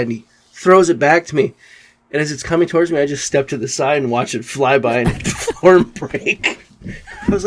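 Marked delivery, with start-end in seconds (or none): none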